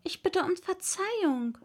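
put-on meek girl asking pardon. A narrator's reading. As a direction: apologize humbly in a lighter voice. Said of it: "sagte Alice sehr bescheiden".

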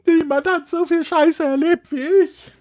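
high, hammy theatrical diva; hammy stage actor. Retold or imitated. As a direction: overact like a tragic diva. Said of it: "so eine theatralische Diva".

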